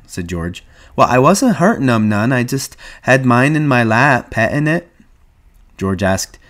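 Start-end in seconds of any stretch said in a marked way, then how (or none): 1.0-4.9 put-on higher, childlike simpleton protesting; Lenny.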